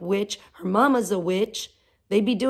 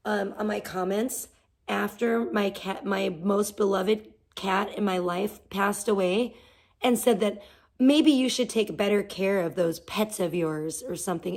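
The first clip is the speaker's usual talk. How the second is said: normal talking voice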